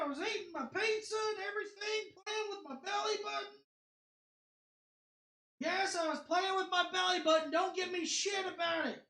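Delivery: high distant shouts from off-mic; calling from another room. Real, not acted. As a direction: yell back from far away. Calling from far off.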